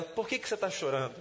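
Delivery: gentle question. A narrator's reading. Jesus gently asks Mary.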